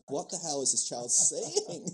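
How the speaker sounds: stretched-out retold disbelief; mock bafflement. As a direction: imitate a bewildered mother, drawn out. Mum baffled by child's new accent.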